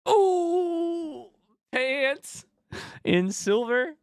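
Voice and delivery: squealed high over the pull; a high thrilled squeal at the card; excited squeal. Real, not acted. Streamer squealing at a card pull.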